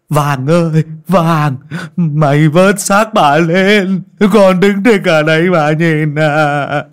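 put-on an old man howling over his dead wife; slow, loud mourning cry between orders; wailing lament. A narrator's reading. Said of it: "lão không kìm lòng được".

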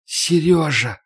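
soft, drawn-out dying call; weak grieving groan; anguished moan. A narrator's reading. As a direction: moan a loved one's name, mortally wounded. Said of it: "простонала она".

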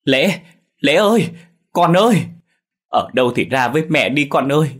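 high, loud cry of a frantic mother; desperate wailing cries. A narrator's reading. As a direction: cry out for a missing child, pleading. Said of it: "miệng bà không ngừng gào lên".

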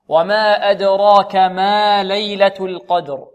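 slow chanted recitation, القدر with light lam and heavy qaf; tajweed recitation. Shown as a demonstration. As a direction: recite the verse slowly. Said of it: "كذلك مثل الأولى، ترقيق اللام وتفخيم القاف". (ترتيل الآية ببطء)

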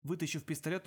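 usual speaking voice

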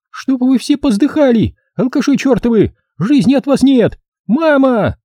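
high-pitched scolding woman; shrieking mother. Acted. Mother cursing drunks and calling granny, high yelling. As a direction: screech like an outraged mum. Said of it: "заорала она старухе".

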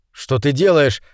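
ordinary delivery, nothing marked

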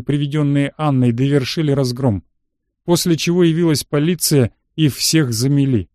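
ordinary delivery, nothing marked

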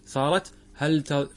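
ordinary talk

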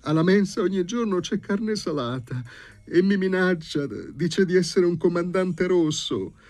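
very high, loud plaintive wailing; high-pitched tearful complaint; tearful young lady. A narrator's reading. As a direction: pour out the grievance in a high voice. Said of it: "piangeva la signorina".

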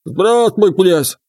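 croaky frog greeting; high croaking water spirits. Acted. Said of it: "наперебой заквакали они".

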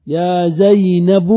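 loud, drawn-out "zaynabu" for "زينب"; full vocative form. Shown as a demonstration. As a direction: slowly sound all of "زينب", ending in "bu". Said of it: "كذلك". (نطق "زينب" كاملاً بصوت عالٍ مع ضم الباء)

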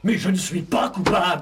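cri indigné, plus fort; protestation criée. Acted. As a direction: clamer son innocence. Accusé proteste. (protestation indignée et forte)

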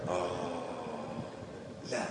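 normal talking voice

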